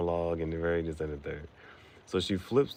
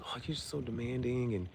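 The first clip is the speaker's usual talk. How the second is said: mocking complaint; 7 semitones higher, 4 dB quieter than usual. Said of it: "but she does an impersonation".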